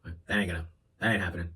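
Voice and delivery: a lightly voiced stern father; old school dad. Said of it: "the old school reaction of being like".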